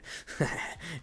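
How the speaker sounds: short wicked laugh; villain chuckle. Acted. Outlaw gloating over his captive, villain chuckle.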